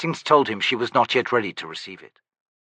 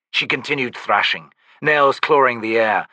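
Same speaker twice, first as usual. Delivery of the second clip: ordinary delivery, nothing marked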